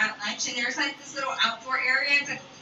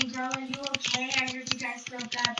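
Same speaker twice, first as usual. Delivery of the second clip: put-on softer, sympathetic girlfriend voice; concerned friends; 4 dB quieter than usual. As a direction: gently ask if she's okay. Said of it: "My friends are coming up to me".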